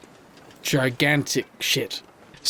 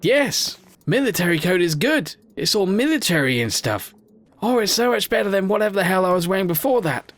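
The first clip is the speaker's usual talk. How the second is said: delighted; 8 semitones higher than usual. Real, not acted. Delighted at finding coat.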